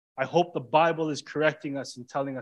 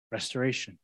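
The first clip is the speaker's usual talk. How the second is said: soft echo; 4 semitones lower, 8 dB quieter than usual. Shown as a demonstration. Repeats key word softly.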